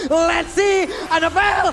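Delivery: loud drawn-out caster call; hype shout. Real, not acted. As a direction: call it out to the crowd. Caster hyping a decisive play. (teriakan komentator yang heboh)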